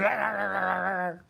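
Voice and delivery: high-pitched mock dog snarling; imitated dog attack. Host acting out the dog biting in the film, mock snarls. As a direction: rattle out a rapid cartoon dog growl. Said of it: "and the dog goes".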